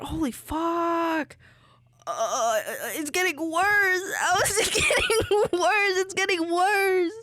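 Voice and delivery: high, tearful half-laughing cry; teary from chopping onions. Real, not acted. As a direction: cry through stinging eyes. Crying from onion fumes, eyes burning.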